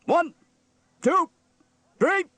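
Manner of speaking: very high, loud, separated counts; barked count-in. A narrator's reading. Steele counts in the band, barking. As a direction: bark each number with a gap.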